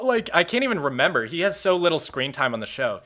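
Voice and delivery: ordinary delivery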